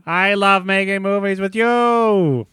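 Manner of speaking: high and slow, drawn out; slow high goofy voice. Acted. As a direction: savor each word, high and slow. Hank echoes slowly.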